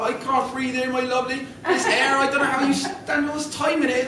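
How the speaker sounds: high West Country fish gasping; high, breathless West Country fish; fish in West Country accent. Acted. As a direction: complain about the air, West Country. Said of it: "get into the accent here".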